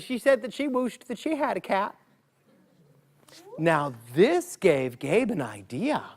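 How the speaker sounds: plain reading voice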